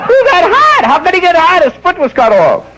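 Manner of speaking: high falsetto, laughing through it; outraged nurse. Acted. Nurse scolds the horny patient, high falsetto with laughter. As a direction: squeal it like a scandalised nurse.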